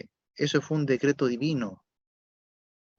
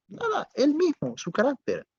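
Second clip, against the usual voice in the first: soaring high answer; 13 semitones higher than usual. Real, not acted. Climactic answer in sermon.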